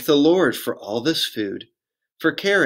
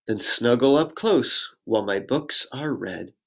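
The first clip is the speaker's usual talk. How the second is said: usual speaking voice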